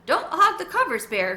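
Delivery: put-on high, bright child's plea; lightly voiced little boy; boy's voice. A narrator's reading. Carlos tucking in his bear, voicing a small boy.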